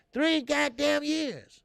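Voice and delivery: incredulous slow shout; emphatic outburst. Real, not acted. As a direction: drag out the years in disbelief. Hammering his point.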